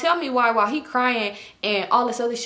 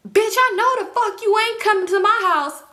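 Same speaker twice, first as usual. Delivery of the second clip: high, loud furious yell from the doorway; angry yell; 12 semitones higher, 5 dB louder than usual. Retold or imitated. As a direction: yell it at the intruder, higher and louder. Angry mother yells at girls.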